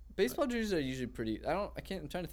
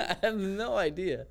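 laughing; 6 semitones higher, slower than usual. Real, not acted. Laughing along.

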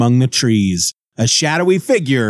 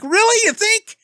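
very high, eager and hopeful; hopeful surprise. Retold or imitated. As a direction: leap up high, hoping she means it. Frank hopeful again, retold scene.

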